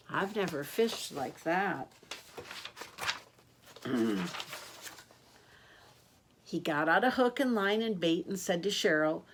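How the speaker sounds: ordinary delivery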